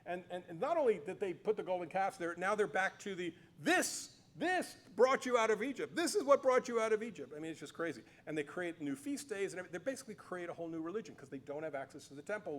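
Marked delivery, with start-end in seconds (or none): 3.6-7.3 raised, louder voice, hamming up a grand announcement; mock proclamation. Teacher mimicking the northern tribes hailing their golden calves, mock grand. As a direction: proclaim it like a showman unveiling an idol.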